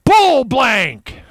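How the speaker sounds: loud, high outraged yell; mock outraged cry. UFO experts on Twitter, loud outraged cry. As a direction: blurt it out like an angry skeptic. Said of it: "on Twitter right now crying".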